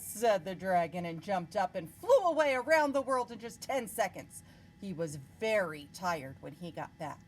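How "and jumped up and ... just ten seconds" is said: ordinary delivery, nothing marked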